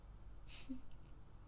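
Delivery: very quiet breathed laugh; faint scoff. Acted. Vampire dismissing fear of losing the human, faint scoff.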